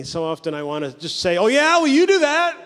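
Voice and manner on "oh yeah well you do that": high, loud mock-defensive comeback; prideful retort. Preacher answering somebody who corrects him, defensive retort. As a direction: throw it back, pitched way up. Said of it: "this is the pride in me".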